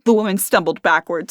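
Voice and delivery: usual speaking voice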